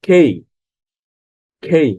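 খেই with a puff of air after the K; aspirated K. Shown as a demonstration. Teacher models the letter's name for learners, aspirated K. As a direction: say খেই as aspirated "khei", not plain "kei". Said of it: "এটিকে বলতে হবে".

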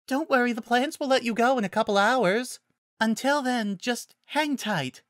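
very high, sweetly gentle voice; reassuring Fluttershy. Acted. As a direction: reassure kindly, slowing at the end.

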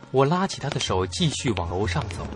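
plain reading voice

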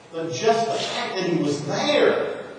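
ordinary talk

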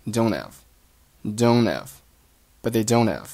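"don't have" linked as "don-av", h silent; linking. Shown as a demonstration. Teacher showing learners linked words, "don't have" as "don-av". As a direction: run "don't have" together, dropping t and h. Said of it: "这两个单词就连读成了".

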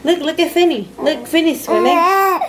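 high crooning there there; soothing there there. Real, not acted. Mother hushing her crying baby, high croon.

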